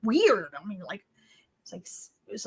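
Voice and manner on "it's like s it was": embarrassed whisper, much softer. Real, not acted. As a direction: drop to a whisper over the awkward word. Embarrassed about saying sex.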